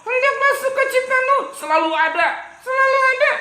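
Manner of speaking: put-on high child puppet reciting. Puppet echoes the teacher, high voice. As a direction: chirp the verse back.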